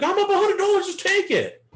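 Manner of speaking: hammed-up impatient trader; mock eager trader. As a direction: squeak it out like a jumpy newbie. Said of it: "You're like".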